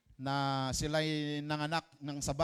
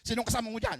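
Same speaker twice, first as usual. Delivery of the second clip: suspicious mother; 6 semitones higher, 5 dB louder, much faster than usual. Acted.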